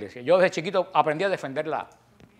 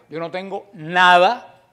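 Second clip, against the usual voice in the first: drawn out and louder, leaning on every word; slow it down and punch each word; slow emphatic; 6 dB louder, much slower than usual. Real, not acted. Preacher refusing to argue, slow and emphatic.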